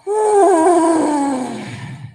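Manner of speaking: long loud audible sigh out; dramatic sigh. Shown as a demonstration. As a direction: heave a big releasing sigh. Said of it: "再嚟吸".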